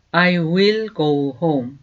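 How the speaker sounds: slow, clear teacher's model, each word apart; slow demo pronunciation; slow model sentence. Shown as a demonstration. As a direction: say it slowly for students to repeat. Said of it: "저절로 나오시도록 자꾸 연습을".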